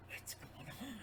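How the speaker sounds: soft unvoiced aside; whispered confusion. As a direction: whisper it bewildered.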